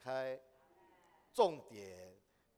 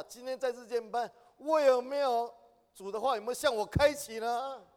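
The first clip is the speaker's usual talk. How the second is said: fervent exhortation; 8 semitones higher, 9 dB louder than usual. Real, not acted. Preacher urging self-examination.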